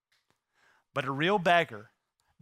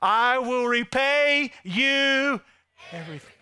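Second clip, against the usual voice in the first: proclaimed for repeating; 6 semitones higher, 5 dB louder than usual. Shown as a demonstration. Preacher having the congregation say it along, loudly proclaimed. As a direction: lead the crowd, stretching each word. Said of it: "Say it with me".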